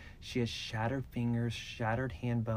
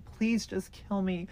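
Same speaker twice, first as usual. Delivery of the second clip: retold plea of a broken victim, much higher; begging to die; 6 semitones higher than usual. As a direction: beg for death in a strained high voice. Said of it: "She was like".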